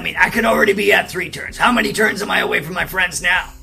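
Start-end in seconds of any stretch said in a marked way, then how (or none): none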